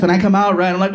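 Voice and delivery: ordinary delivery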